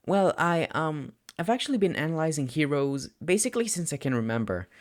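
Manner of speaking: put-on a shy boy confessing too much; lightly voiced teen boy, anxious and rushing; nervous young student. A narrator's reading. Narrator voices Midoriya.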